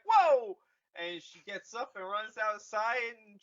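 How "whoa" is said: a high, loud startled whoa; loud surprised whoa; mock surprise. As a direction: jump at the sound, hammed up. Rey hears beeps.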